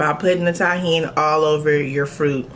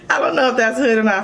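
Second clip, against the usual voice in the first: laughing; 6 semitones higher, faster than usual. Real, not acted. Laughing at own snack.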